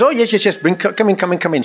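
high-pitched imitation of a hurried nun; mimicked nun, brisk and high; bustling sister. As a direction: usher the visitor in with high, quick repeats. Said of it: "they all sort of talk like this".

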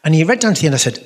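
ordinary talk, nothing marked

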